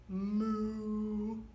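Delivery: a cow's moo. Acted. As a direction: moo like a cow. Dog says moo.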